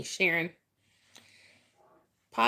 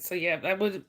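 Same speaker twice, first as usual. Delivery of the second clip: usual speaking voice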